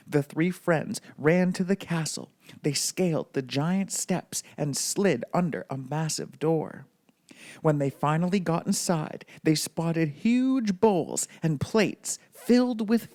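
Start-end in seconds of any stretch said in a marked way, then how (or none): none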